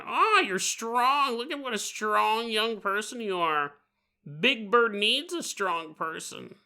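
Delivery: creepy flattering bird; Big Bird. Acted. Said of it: "Big Bird is feeling your muscles and going".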